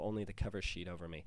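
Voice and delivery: ordinary delivery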